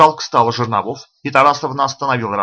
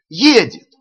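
much higher, louder excited yell; loud shouting; 9 semitones higher, 6 dB louder than usual. A narrator's reading. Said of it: "закричали". (ещё громче кричат)

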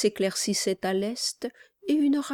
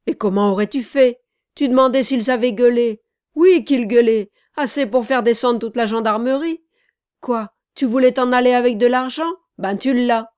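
loud, rapid, raised-voice quarrel; angry retort; 5 semitones higher, 10 dB louder, faster than usual. A narrator's reading. Accomplices quarrelling over the money, angry retort. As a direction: fire it back hotly, fast and loud. Heated quarrel after the crime.

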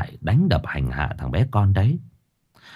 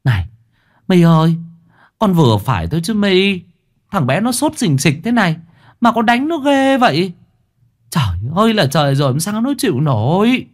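angry scolding; 8 semitones higher than usual. A narrator's reading. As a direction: rebuke her sharply, an old neighbour fed up. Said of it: "bực tức quát My".

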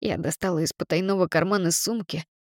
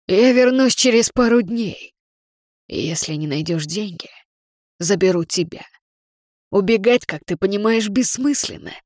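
loud threat; 5 dB louder than usual. Acted. Villain threatens to return.

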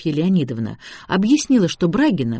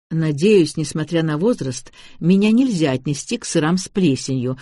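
plain reading voice

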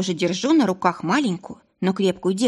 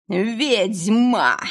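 put-on drunken howl; 3 semitones higher, 3 dB louder than usual. Drunk attacker shouting.

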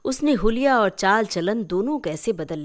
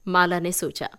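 ordinary delivery, nothing marked

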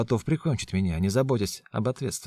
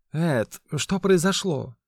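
slow, pleading, higher; plaintive question; 8 semitones higher, 5 dB louder, much slower than usual. A narrator's reading. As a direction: ask pitifully, slowly. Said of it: "спрашиваю жалобно". (жалобно, медленно)

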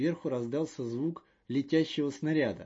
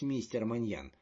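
plain reading voice

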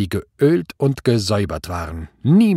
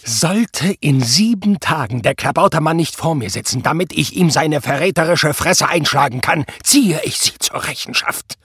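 raised, menacing ultimatum; warning threat; 6 semitones higher, 4 dB louder than usual. A narrator's reading. Prall gives his officer a seven-day ultimatum, threatening. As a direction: warn him with mounting anger. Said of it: "Mahnend hob Prall den Zeigefinger".

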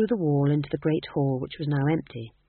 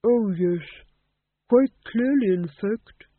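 put-on cheerful old ghost king, higher; 5 semitones higher, 5 dB louder, slower than usual. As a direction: genial ancient ghost. Old ghost Champot voiced.

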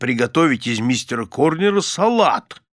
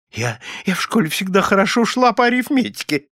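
higher-pitched, flustered stammer; stammering mutter; 8 semitones higher, faster than usual. A narrator's reading. Wife defending her arithmetic to husband, stammering. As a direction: stumble into a timid protest, voice up. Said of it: "заикаясь, забормотала миссис Корнер".

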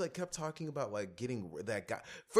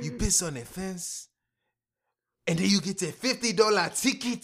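hammed-up comedian impression; 7 semitones higher, 8 dB louder than usual. Friend acting out the comedian's fence bit, hammed-up high voice. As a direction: play the comic like an outraged foreigner.

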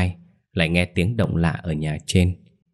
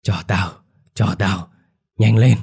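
gritted teeth, rising to a bark; through gritted teeth; 4 dB louder than usual. A narrator's reading. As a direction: demand it through clenched teeth. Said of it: "nghiến răng ... cản giọng".

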